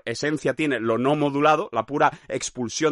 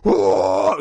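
low, louder animal howl; animal cry; 7 semitones lower, 6 dB louder than usual. Pretend. Primal scream of pain, low loud howl. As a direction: let out a raw animal howl. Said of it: "como de animal".

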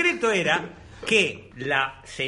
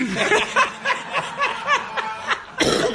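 giggling ghost laugh. Retold or imitated. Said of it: "una voz de espíritu".

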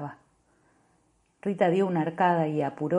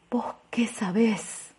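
slow unvoiced whisper, turned away; hushed defiant whisper; 4 semitones higher, 3 dB quieter, slower than usual. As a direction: breathe it out, defensive. Woman refusing, whispered reply.

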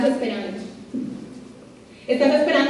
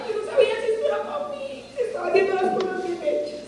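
higher and softer, close to tears; tearful; 7 semitones higher, 7 dB quieter than usual. Real, not acted. Woman recalling her doubts while crying, tearful. Retelling crying after call.

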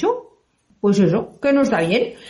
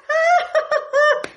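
put-on child's laugh. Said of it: "va un niño y dice".

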